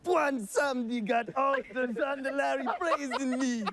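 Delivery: high, laughing put-on Zandalari accent; Bonsamdi accent. Actor attempts Bonsamdi's accent while laughing. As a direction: attempt the troll accent, cracking up. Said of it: "the way the accent is".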